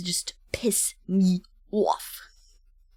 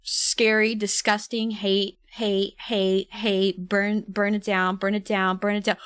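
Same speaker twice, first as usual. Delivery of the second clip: normal talking voice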